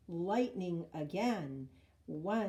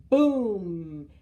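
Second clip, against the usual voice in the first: bellowing boom, very loud; loud thunder boom; 2 semitones higher, 13 dB louder, much slower than usual. A narrator's reading. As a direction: roar it like thunder. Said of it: "The thunder bellowed".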